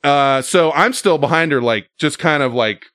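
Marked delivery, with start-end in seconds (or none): none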